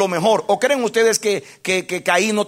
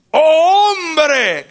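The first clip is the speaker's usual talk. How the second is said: a high, loud, crying "Oh hombre" as God; God calling out to man in anguish; God's anguished cry; 9 semitones higher, 6 dB louder than usual. Acted. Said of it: "cambió el tono".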